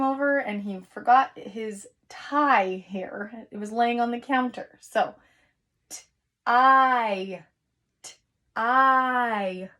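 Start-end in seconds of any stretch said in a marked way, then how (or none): 5.9-9.7 "t-I" split, the long I stretched and louder; segmented long I. Shown as a demonstration. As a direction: break the word, sing out the I. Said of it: "what long vowel do we hear".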